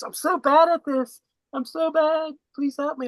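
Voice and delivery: high, slow mock wail; imitated crying. Woman collapsed on the beach begging for help, mock crying. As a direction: wail it pleadingly, imitating someone in tears. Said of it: "She starts crying".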